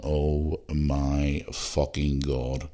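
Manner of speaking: slow, stretched-out oh my God; mock-stunned, dragged-out groan of words; drawn-out disbelief. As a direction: let each word hang in disbelief. Stunned aside in Uber car.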